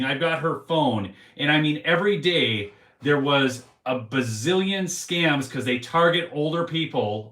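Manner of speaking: usual speaking voice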